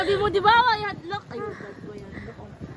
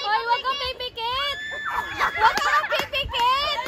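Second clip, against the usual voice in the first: shouted instruction; 5 semitones higher, slower than usual. Real, not acted.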